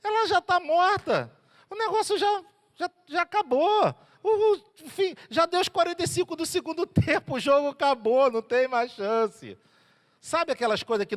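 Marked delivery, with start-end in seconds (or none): 0.0-9.6 much higher, scornful crowd voice; mocking crowd. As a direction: sneer that it's over. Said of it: "O pessoal começou a rir".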